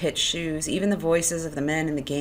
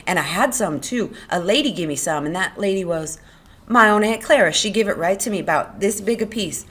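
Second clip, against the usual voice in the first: excited; 4 semitones higher, 6 dB louder than usual. A narrator's reading. Lenny excitedly recalls velvet.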